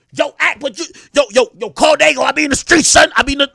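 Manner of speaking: mock Sharpie rant. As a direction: play the rival shouting back. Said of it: "let's argue at each other".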